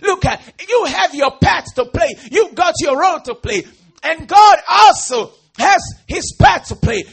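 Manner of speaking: loud shouted sermon; fiery preacher in full flow; full-force preaching. Real, not acted. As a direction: thunder it at the congregation.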